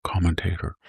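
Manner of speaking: a light, breathy public radio hush; mocking a soft NPR commentator; mock NPR whisper. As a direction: whisper the word like a hushed radio host. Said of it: "talk like an N P R".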